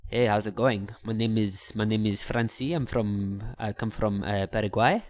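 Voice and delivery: low-pitched mock French accent; put-on French accent. Foreigner introducing himself to a girl, put-on French accent. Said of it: "that would be from France".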